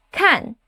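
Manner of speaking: "Kan." from high straight down; fourth tone. Shown as a demonstration. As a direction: drop "Kan." sharply, like a command. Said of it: "The fourth tone drops fast".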